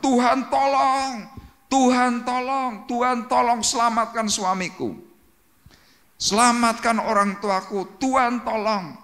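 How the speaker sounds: high, slow, pleading wail to God; desperate crying plea. Retold or imitated. Wife and children crying to God at deathbed, desperate wail. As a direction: beg God to save him, voice straining high. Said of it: "anak-anaknya teriak-teriak".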